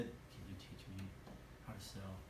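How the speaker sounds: very quiet, low murmur; Mike's timid mumble. Retold or imitated. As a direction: imitate a man with no confidence, barely audible. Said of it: "that's how he talked".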